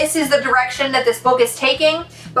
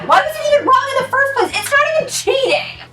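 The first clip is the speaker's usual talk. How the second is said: much higher, fast, shouted protest; outraged shout; 12 semitones higher, faster than usual. Real, not acted. Reviewer furious at the cheating plot, shouting. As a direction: yell it in exasperation.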